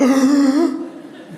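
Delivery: sharp high mock gasp of shock. Friends gasp when Michaela suddenly sits up.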